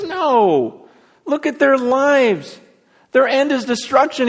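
high, drawn-out protest; emphatic refusal. Retold or imitated. Preacher voices Paul rebutting false assurance, emphatic refusal. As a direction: refuse hard, stretching the key words.

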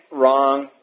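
ordinary talk, nothing marked

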